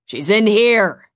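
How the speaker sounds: loud, slow, drawn-out call; calling out flatly. A narrator's reading. Boy calls out that he found her, flat loud call. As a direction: call it out to someone outside the room. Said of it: "Finn called out flatly".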